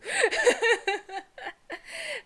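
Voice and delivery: a high loud laugh tangled with a sob; laugh-cry. Real, not acted. Fan overwhelmed by the panel, laughing and crying at once. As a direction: burst out laughing through tears. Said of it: "Also made me cry again".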